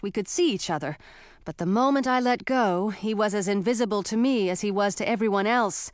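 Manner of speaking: plain reading voice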